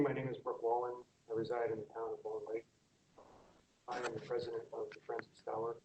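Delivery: soft, barely audible; speaking too softly; too quiet. Real, not acted. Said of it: "Could you speak up, please? We can't hear you".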